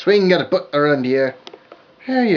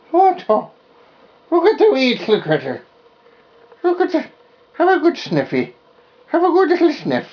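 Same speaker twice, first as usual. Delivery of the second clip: high, cooing puppy voice; high baby talk; 7 semitones higher than usual. Acted. Puppy chewing her finger.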